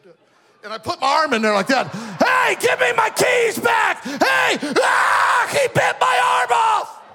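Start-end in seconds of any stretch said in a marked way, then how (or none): 1.9-7.0 high, loud frantic shouting; hammed-up cry for help from a bitten buddy; panicked yell. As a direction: yell in mock panic as if the arm is gone. Acted panic yell in classroom prank.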